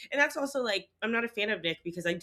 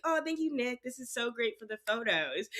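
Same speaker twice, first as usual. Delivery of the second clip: high, sugary mock gratitude, a little softer; imitating Courtney; 5 semitones higher, 5 dB quieter than usual. Friend mocks Courtney thanking her husband, sugary high voice. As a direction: gush with fake thanks, mocking her. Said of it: "I could see her being like".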